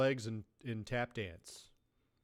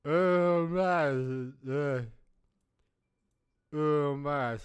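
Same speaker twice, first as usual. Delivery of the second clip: put-on drunk bar order; 6 dB louder, much slower than usual.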